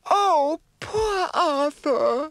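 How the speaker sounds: very high, slow, crying fairy voice; sad fairy. Acted. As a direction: sob it out as a tearful fairy. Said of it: "said Mavis".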